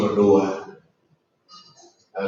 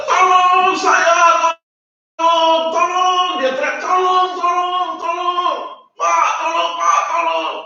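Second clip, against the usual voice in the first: put-on child crying for help; slower than usual. Preacher voicing a stranded child screaming for help to a ship. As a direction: wail tolong like a stranded child. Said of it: "Dia teriak begitu".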